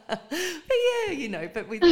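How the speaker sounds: high, loud words still shaking with laughter; laughing through words. Real, not acted. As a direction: talk while the laugh runs out. Still laughing after the joke.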